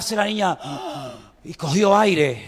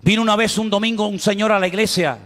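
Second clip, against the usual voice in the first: ordinary delivery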